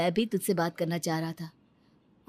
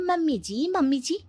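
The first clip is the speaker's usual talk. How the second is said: mock-sweet call with rising ends; imitating her own polite tone to her mother-in-law, higher; sweetly polite mimicry; 6 semitones higher than usual. Daughter-in-law mimicking her polite address, mock-sweet. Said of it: "अच्छे से बोल रही हूँ".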